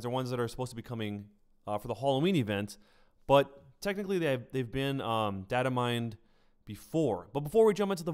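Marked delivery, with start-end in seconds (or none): none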